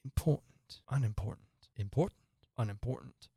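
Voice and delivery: soft muttering to himself; undertone. A narrator's reading. King tries which word sounds best, in an undertone. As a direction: test each word under your breath. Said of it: "went on to himself in an undertone".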